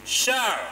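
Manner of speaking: shall lifted high, set apart; stress on SHALL. Shown as a demonstration. Lawmaker quoting the law, stressing shall. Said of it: "again, emphasis on shall".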